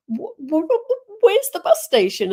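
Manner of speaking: stammering, pitch climbing high in panic; flustered stammer. Retold or imitated. Lost traveller asking staff at the moved bus station, flustered stammer. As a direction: stumble over the wh- sounds, lost and rattled. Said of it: "I was completely thrown".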